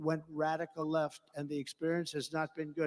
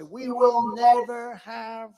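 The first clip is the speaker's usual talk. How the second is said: slow, loud and high declaration; slow emphatic vow; 5 semitones higher, 8 dB louder, slower than usual. Retold or imitated. Quoting his own speech to Congress, slow and emphatic. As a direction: deliver it like a State of the Union line. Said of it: "I would say".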